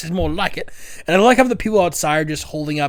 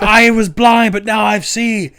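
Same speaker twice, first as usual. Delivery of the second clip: higher, louder mock testimony; healed follower; 6 semitones higher, 6 dB louder, slower than usual. Follower claiming a miracle, in a hammed-up high cry. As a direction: proclaim the cure, hammed up. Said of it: "it's like".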